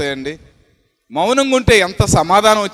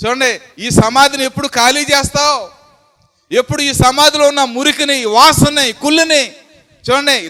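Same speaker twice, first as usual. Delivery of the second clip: rising, louder, higher challenge; fiery preaching; 4 semitones higher, 3 dB louder than usual. Acted. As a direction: hammer each question home. Preacher's building rhetorical questions. (ఆవేశంగా ప్రశ్నించే ప్రసంగం)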